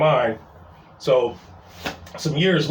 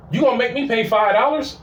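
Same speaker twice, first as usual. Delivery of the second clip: higher-pitched, imitated disbelief; mock indignant question; 6 semitones higher than usual. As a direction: ask like an affronted customer, rising at the end. Customer's first challenge at parking sign.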